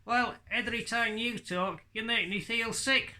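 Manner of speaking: high, cheeky puppet insulting the host; sassy high ventriloquist dummy. Acted. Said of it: "a puppet like Hadley".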